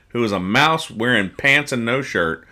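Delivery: normal talking voice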